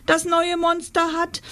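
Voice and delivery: high urgent cry, cut off; screamed call. A narrator's reading. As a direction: call out urgently until interrupted. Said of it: "schrie sie so laut".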